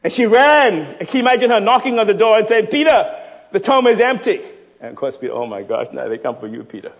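loud, high, driving sermon voice; full-force preaching. Acted. Preacher rousing the church, loud and high. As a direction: preach it hard to the congregation.

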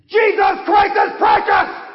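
high, full-force pulpit shout; shouting old preacher. Acted. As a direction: make the rafters ring with it. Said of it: "the old preacher shouted anew".

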